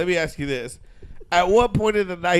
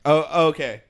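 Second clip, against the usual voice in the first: usual speaking voice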